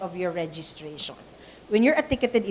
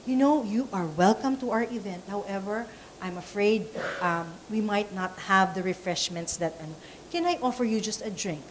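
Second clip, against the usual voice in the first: soft, gentle and polite, a little quieter; said nicely; 2 semitones lower, 7 dB quieter than usual. Shown as a demonstration. Speaker demonstrating to trainees, soft polite turn-away. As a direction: offer it kindly, like a gracious host. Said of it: "to say nicely".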